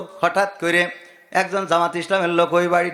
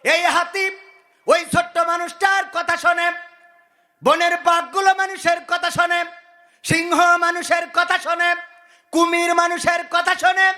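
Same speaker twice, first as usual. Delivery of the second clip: chanting sermon with sudden high-pitched surges; singsong preaching; 4 semitones higher than usual. Acted. Preacher to congregation on animals obeying man, singsong exhortation. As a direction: preach in sustained tones, stretching the phrase endings.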